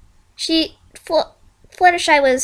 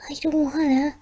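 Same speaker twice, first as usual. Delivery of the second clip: scared whisper; 2 semitones higher, 6 dB quieter than usual. Acted. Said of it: "said Fluttershy".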